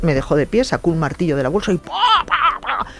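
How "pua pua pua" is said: high, explosive vocal banging; imitated hammer blows. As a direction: act out each hammer blow with a burst. Imita los martillazos de su madre. (martillazos imitados con la voz)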